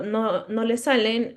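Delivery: ordinary delivery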